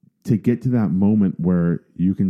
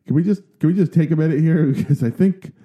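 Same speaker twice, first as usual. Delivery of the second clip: high, louder joking guilt; playful mock confession; 8 semitones higher, 4 dB louder than usual. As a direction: ham up taking the blame. Coach quoted, mock guilty.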